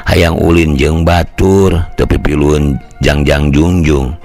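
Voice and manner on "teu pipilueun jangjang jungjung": ordinary delivery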